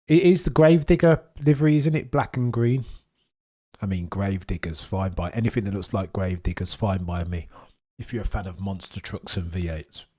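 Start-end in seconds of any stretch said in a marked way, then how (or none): none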